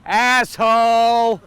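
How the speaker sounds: loud, drawn-out holler; yelled insult. Retold or imitated. Passing local to man on the roadside, yelling. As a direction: yell it long out of a car window. Local yells out car window.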